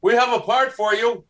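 high, loud mock announcement of good news; hammed-up producer voice; quoting the producer. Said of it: "And then".

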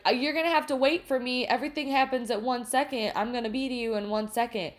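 fast, flustered mock brush-off; hurried waitress. Waitress putting off the old man, fast flustered voice. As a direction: rattle it off like a busy waitress. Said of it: "then she's like".

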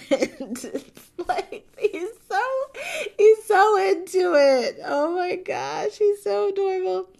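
high, giggly gushing over how adorable he is; laughing gush. Real, not acted. Gushing to viewers about John, laughing and high. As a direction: laugh through the words, smitten.

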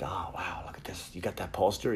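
very soft, half-whispered mock awe; hushed admiration. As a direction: play the impressed friend under your breath. Friends admiring his childhood poster.

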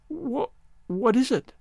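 high, slow and stammering; frightened. A narrator's reading. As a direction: stumble out the question in fear. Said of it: "Now, don't be frightened".

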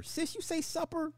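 high squeaky disbelief at "supper"; can't believe what you're reading; incredulous. Real, not acted. Streamer reacts to a viewer who says supper, high and incredulous.

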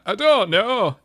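high, loud Northern English "I don't know"; a broad Northern English accent; quick burst of Northern English accent. Shown as a demonstration. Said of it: "unless I do a northern line".

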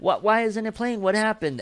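what happened, said high and baffled; baffled surprise. Retold or imitated. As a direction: replay the shock of the song vanishing. Retold own reaction to missing song.

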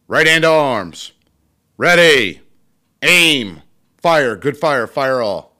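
loud barked toast commands, steady and high; barked firing squad commands. Shown as a demonstration. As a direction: bark the drill orders to the hall. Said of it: "I will toast him as well".

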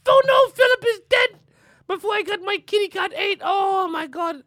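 hammed-up royal wail, very high and loud; Queen Elizabeth. The queen finds Philip dead, high mock-distraught cry. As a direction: cry out in mock-British falsetto dismay. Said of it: "it's her accent".

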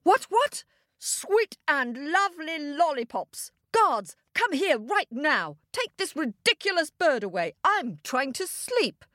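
high-pitched, outraged king; roaring king. A narrator's reading. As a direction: exclaim like a king jolted from sleep. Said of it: "roared the king".

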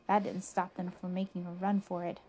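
plain reading voice

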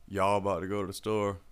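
ordinary delivery

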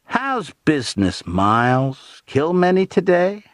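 high, needling mock friendliness; shocking mockery. A narrator's reading. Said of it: "shocking mockery I had heard in Billy's".

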